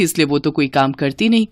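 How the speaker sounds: ordinary delivery, nothing marked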